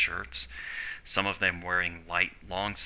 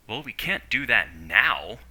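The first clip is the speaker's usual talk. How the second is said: louder, slow, as if it's his fault; slow, emphatic blame, higher and louder; blaming the captive; 4 semitones higher, 7 dB louder than usual. A narrator's reading. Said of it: "like it was his fault".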